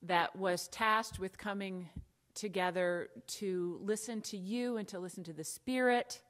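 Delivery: ordinary delivery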